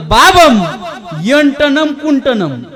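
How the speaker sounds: loud, high, drawn-out pseudo-Sanskrit call; mock Sanskrit. Son phones father to ask for a farm tool, loud mock Sanskrit. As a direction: intone it slowly, ringing out each -am ending. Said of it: "याला संस्कृतमध्ये विचारणं बी अवघड".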